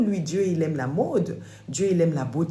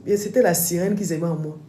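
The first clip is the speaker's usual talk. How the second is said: usual speaking voice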